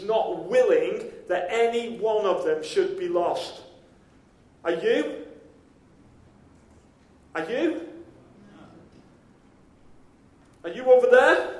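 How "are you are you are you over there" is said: rising calls, the last one louder and higher; searching call that grows louder and higher. Pretend. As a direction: call out into the distance as if searching for someone lost. Calling out for lost sheep.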